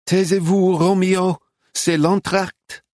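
high, slow scolding of a pet; scolding a dog. A narrator's reading. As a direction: hush the little dog firmly. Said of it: "Woman to dachshund".

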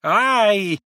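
high, loud startled cry; old woman's startled shriek. A narrator's reading. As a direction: yelp in fright as an old woman. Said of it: "закричала старушонка".

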